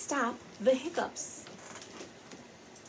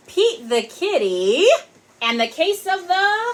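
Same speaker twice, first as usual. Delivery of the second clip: dramatic title read; 9 semitones higher, 6 dB louder than usual. Acted. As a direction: build suspense on the title. Reading title dramatically to kids.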